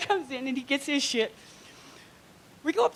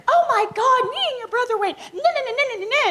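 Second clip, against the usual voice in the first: much higher, loud giddy gossip; gushing girlfriend; 13 semitones higher, 4 dB louder than usual. Retold or imitated. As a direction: squeal it like a girlfriend spilling secrets. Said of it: "from all his girlfriends".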